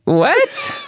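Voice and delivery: high squeak of disbelief; squeaky surprise. Real, not acted. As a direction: shriek the question up. Shocked by the unseen swings.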